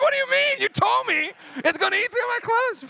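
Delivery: a squeaky, outraged reply to the doctor about the ruined shirt; mock outrage. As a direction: play yourself as a shrill cartoon.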